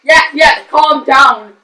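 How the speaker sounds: loud, insistent call for calm; loud calming shout. Acted. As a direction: shout over the screaming to settle them. Quieting the screaming co-hosts.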